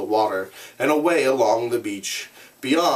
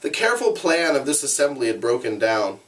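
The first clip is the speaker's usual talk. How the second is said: ordinary delivery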